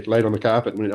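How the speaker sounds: normal talking voice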